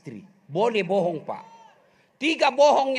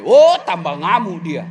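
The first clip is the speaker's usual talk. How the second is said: ordinary talk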